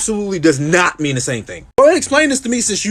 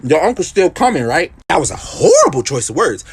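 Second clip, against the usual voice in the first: usual speaking voice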